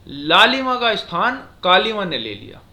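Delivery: loud, slow, measured लालिमा का स्थान कालिमा ने ले लिया; resume after a long stop, stretching लालिमा and कालिमा; after semicolon pause. Shown as a demonstration. Teacher reads the semicolon example, slow and loud from लालिमा. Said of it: "कुछ ज्यादा समय हमें रुकना पड़ता है".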